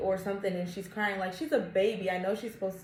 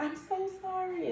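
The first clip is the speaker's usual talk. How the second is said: imitating a high, soft apology; apologetic mom; 10 semitones higher, 6 dB quieter than usual. Mom at sushi spot apologizing for crying baby, high and soft. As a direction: mimic her flustered sorry. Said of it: "and I was just like".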